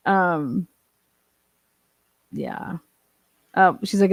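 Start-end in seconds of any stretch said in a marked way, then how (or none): none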